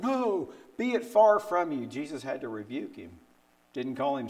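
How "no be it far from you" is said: high, urgent protest; protesting plea. Retold or imitated. Peter urging the Lord, in a high pleading protest. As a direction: plead against it, alarmed. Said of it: "Told the Lord not to go".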